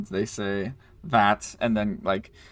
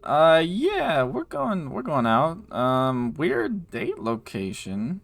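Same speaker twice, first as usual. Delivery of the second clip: higher, hesitant orc character voice; puzzled orc guy, pitched up; 6 semitones higher, much slower than usual. Acted. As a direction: answer the stranger in a raised, drawn-out voice. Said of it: "says the, like, the orc guy".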